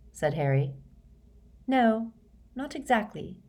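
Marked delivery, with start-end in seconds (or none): 1.7-3.4 higher-pitched, halting reply with a pause; hesitate, unsure how to put it; hesitant, slowly. A narrator's reading. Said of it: "said Hermione slowly".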